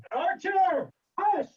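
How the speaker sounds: sharp, high shout at the dog; scolding the dog. Real, not acted. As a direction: snap a command to hush the dog.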